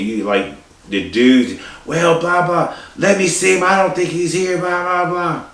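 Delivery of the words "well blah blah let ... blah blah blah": put-on high, slurred drunk babble. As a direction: mumble like a drunk dad. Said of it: "you know that drunk voice?".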